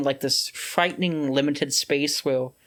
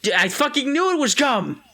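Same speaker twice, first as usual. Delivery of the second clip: triumphant shout; 8 semitones higher than usual. Real, not acted. Catching friend chewing gum.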